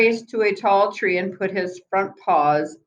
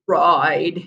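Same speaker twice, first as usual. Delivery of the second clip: stammered. A narrator's reading. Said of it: "he stammered".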